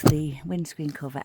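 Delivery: normal talking voice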